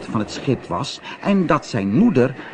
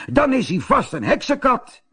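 another sailor; 12 semitones higher than usual. A narrator's reading. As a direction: tell it with wonder. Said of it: "zei een ander".

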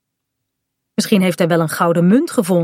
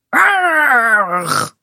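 higher, long pirate "Arr"; pirate cry; 6 semitones higher than usual. Acted. Kaat draws her dagger too, crying out as pirate. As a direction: call out a high growling "Arr", falling. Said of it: "roept Kaat ook".

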